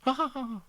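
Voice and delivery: high, soft put-on laugh; a gentle, distant ha-ha; soft laughter. A narrator's reading. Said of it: "he heard soft laughter".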